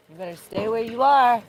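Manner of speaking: loud, high-pitched warning; warning shout. Real, not acted. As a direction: call out to keep it back. Warning something in the bushes.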